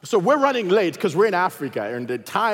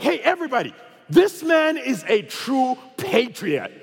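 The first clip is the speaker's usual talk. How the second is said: loud public announcement; 7 semitones higher than usual. Retold or imitated. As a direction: announce it grandly to the whole plane. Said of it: "loud enough for the entire flight to hear".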